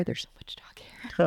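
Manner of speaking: a hushed little grumble, voiceless; whispered aside. Real, not acted. Muttering to her cohost about dog hair, whispered. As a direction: whisper "There's so much dog hair" under her breath.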